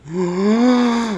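a sharp high gasp of shock. Retold or imitated. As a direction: gasp like you just caught someone. Kid reacts on hearing fart.

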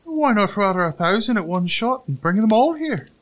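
high, loud, showy little voice; ostentatious tailor. A narrator's reading. Tailor bragging to the giant, high and ostentatious. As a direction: show off in a piping voice. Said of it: "inquired the ostentatious tailor".

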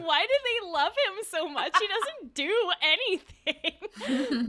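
loud, high mock exasperation; baffled protest. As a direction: wail it in disbelief, slowing and breaking into laughter. Acted baffled protest, laughing.

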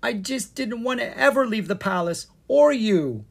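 high-pitched, mildly tearful confession; tearful pleading. A narrator's reading. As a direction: plead as if close to tears. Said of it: "bursting into tears".

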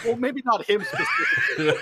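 loud, high laughing protest. Real, not acted. Laughing loudly as he corrects the friend's Thanos guess. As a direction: burst out laughing while correcting.

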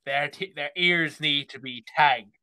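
hammed-up rural farmer accent. Farmer rounding up help with cattle, farmer accent. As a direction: give out farm chores in a farmer accent. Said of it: "a farmer accent".

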